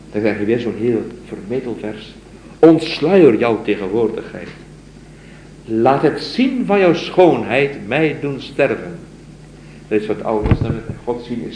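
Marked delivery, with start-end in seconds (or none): 2.6-9.0 raised, slow solemn recitation; poem recital. Acted. Lecturer reciting the mystic's poem to his students, solemn recital. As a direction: recite it like a devoted lover's plea.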